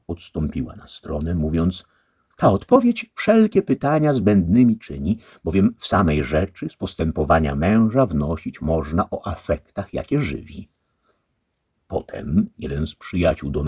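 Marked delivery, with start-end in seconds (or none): 2.4-10.7 wyżej i głośniej, kobiecy głos; dama. A narrator's reading. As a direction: przyznaje rację głowie. Lady comments. (dama rozważa odpowiedź)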